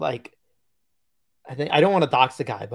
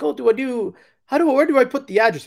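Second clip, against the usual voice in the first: high, loud mock Italian accent; quick, high Italian-accented question; 11 semitones higher, 6 dB louder, faster than usual. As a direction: imitate a confused Italian buyer, pitched up. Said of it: "strong Italian accent".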